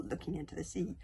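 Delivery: ordinary delivery, nothing marked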